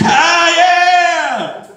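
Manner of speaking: high, loud whooping cheer; hammed-up crowd yelling back; mock crowd cheer. Comic imitating the crowd cheering the band, loud whoops. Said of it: "everybody's like".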